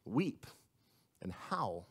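hushed ominous warning. Acted. Hushed dramatic reading.

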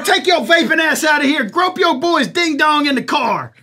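hammed-up bouncer dressing her down; mock telling-off. Voicing the staff telling her off.

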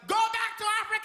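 high, loud mocking shout; mock angry yell. Heckling debtor yelling at comic, mock angry shout. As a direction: fling it like a cheap insult. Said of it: "And he's like".

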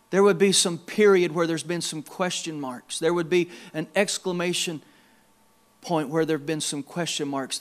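soft, low prayer voice; pastoral prayer. Real, not acted. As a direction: lead them gently in prayer. Leads congregation in prayer.

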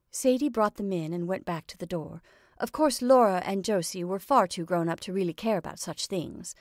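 ordinary delivery, nothing marked

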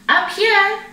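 high, loud and playful; little panda's high happy shout; gleeful cub call. A narrator's reading. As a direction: call out merrily from up in the branches. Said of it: "he laughed as they climbed".

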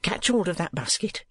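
usual speaking voice

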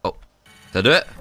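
ordinary talk

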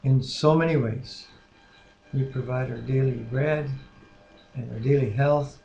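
soft, reverent spoken prayer. Acted. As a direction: lead the prayer gently and sincerely. Husband leads prayer.

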